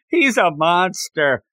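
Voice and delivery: ordinary talk, nothing marked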